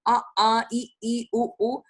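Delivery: lightly voiced parent teaching a toddler; a parent teaching first sounds, lightly voiced; teaching vowels. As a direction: sound out vowels for a small child. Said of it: "शुरू में mummy papa क्या सिखाते हैं?".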